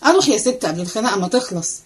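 ordinary delivery, nothing marked

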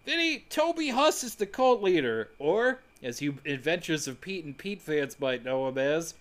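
normal talking voice

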